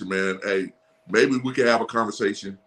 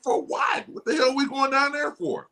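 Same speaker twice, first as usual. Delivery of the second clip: high-pitched disbelief, much higher than his own voice; incredulous; 11 semitones higher than usual. Retold or imitated. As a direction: ask it as if the trade makes no sense at all. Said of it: "I'm like".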